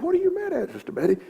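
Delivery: high gentle pastoral voice; gentle concern. Retold or imitated. Preacher voices himself to widow.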